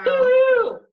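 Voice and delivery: high, loud whoop of triumph; a real, loud victory whoop; excited cheer. Real, not acted. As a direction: cheer it out like you nailed it. Cheering her finished dish.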